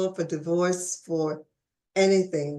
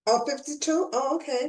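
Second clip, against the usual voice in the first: surprised; 8 semitones higher than usual. Real, not acted. Surprised at company's age.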